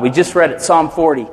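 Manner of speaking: usual speaking voice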